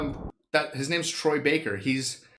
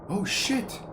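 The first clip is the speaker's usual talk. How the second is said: surprised; 10 semitones higher than usual. Real, not acted. Clicker sound heard on screen.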